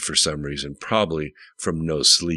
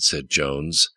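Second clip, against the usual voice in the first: ordinary delivery, nothing marked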